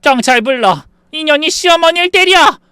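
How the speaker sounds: very high, loud outcry; yelling for police. Acted. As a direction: scream accusingly for help. Mother-in-law yells for police.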